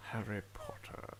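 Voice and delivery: slow whispered Snape. Retold or imitated. Failed Snape attempt on a podcast, slow whisper. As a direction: breathe the name out slow and hushed. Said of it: "Let's try Snape".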